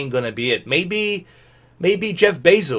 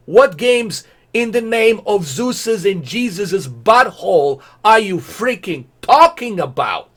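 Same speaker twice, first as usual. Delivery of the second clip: slow, higher, drawn-out exasperated demand; mock outrage; 6 semitones higher, 3 dB louder than usual. Real, not acted. Demanding answers from Google, slow exasperated outrage. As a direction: stretch out the outrage.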